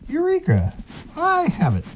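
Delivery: high, bright triumphant cry; excited eureka. Acted. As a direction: shout it like a discovery. Fox finds the answer.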